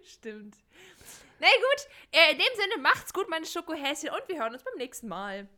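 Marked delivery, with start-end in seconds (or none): 1.4-5.5 high, cheery sign-off to the listeners; higher, chirpy podcast farewell; host goodbye. Acted. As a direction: wave the audience goodbye.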